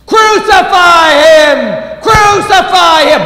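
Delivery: full-force high, drawn-out shout; long, roaring mob cry; crowd crying out. Acted. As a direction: yell it like a mob demanding death. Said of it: "the people cry out".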